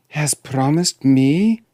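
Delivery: high, slow rising question; incredulous princess. Acted. Princess interrupts the dwarf's news, incredulous. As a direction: draw out the echo in disbelief. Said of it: "interrupted the princess".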